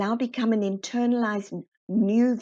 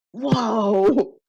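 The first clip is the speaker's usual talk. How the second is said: amazed whoa; 6 dB louder than usual. Retold or imitated. Acting out the colleague's amazed shout, loud and bright. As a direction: blurt it out, bowled over.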